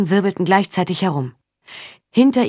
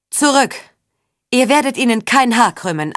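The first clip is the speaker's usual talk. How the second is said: high, raised order; commanding call; 5 semitones higher, 4 dB louder than usual. A narrator's reading. Young woman steps out to protect captives, commanding. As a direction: command them to back off.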